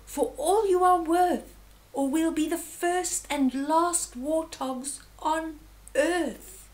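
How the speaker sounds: high, loud fatherly urging; storybook dad crying out in alarm; urgent cry. A narrator's reading. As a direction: spur the family on, pitched high. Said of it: "cried Dad".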